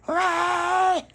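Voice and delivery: high wailing hey; vampire's call. Retold or imitated. Vampire hunting the hiding children, high eerie call. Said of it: "like that".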